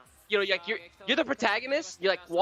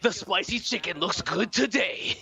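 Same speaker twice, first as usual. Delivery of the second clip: storyteller voice; slower than usual. Pretend. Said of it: "the storyteller voice".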